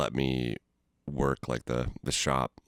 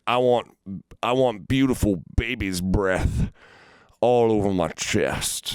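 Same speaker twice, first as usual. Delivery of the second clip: slow, drawn-out mock creep, slightly raised; creepy customer; 4 semitones higher, slower than usual. Imitating a tattoo customer asking for baby's breath, slow and creepy. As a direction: lightly voice a creep savoring the words.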